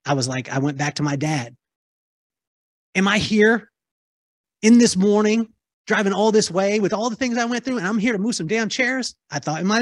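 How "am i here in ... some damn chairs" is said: indignant inner outburst. Retold or imitated. Outraged inner voice at being asked.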